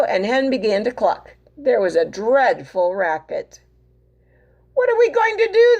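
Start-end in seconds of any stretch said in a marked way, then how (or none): 4.7-5.8 high fast frantic question; panicked cry. Acted. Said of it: "they cried".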